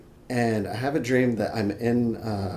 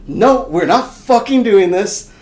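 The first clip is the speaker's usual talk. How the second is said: loud, high, slow refusal; emphatic acted shout; defiant outburst; 10 semitones higher, 11 dB louder, slower than usual. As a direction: act out putting your foot down in a panic. Shoots out of bed refusing.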